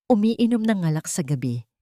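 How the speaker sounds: ordinary delivery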